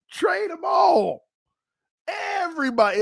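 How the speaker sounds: shout. Real, not acted. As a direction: yell it out like a fan who has had enough. Fan yells trade everyone.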